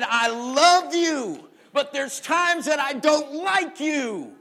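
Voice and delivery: slow, loud, drawn-out mother's words; loud, slow weary-mom quote; slow emphatic quote. Retold or imitated. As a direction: stretch every word like a weary mom. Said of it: "when she said".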